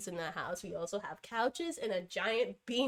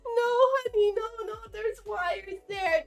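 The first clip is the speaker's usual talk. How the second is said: scolding pet; 12 semitones higher, 7 dB louder than usual. Real, not acted. Stopping cat near wires.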